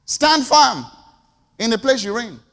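loud, high, firm call; rousing exhortation. Real, not acted. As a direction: command them to stand firm.

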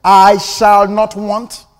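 "I shall not want" said slow, each word weighed; slow pointed stress. Shown as a demonstration. Preacher repeats the line so the congregation hears it, slow and stressed. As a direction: give every word its own weight. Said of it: "Did you hear that?".

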